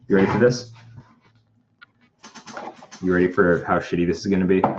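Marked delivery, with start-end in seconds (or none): none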